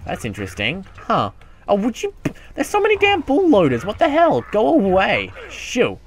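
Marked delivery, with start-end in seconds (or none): none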